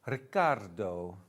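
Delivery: a vague put-on Italian accent on the name; trying for an Italian accent on Riccardo; mock Italian accent. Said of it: "I don't know what accent that was supposed to be".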